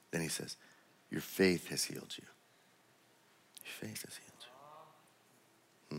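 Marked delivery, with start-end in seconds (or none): 3.6-6.0 breathy whisper of the same line; soft whispered repeat, almost no voice. Acted. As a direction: drop to a secret whisper, intimate.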